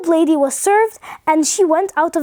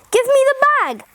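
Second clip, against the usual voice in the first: high-pitched, mildly angry order; storybook anger, pitched up, not shouted; angry demand; 9 semitones higher than usual. A narrator's reading. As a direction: demand it in a put-on temper. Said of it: "very red in the face".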